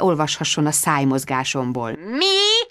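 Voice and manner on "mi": very high, piercing query; puzzled caller. A narrator's reading. Sharon on the phone asks Bridget to repeat, puzzled. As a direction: squawk the question down the line. Caller did not catch the whisper.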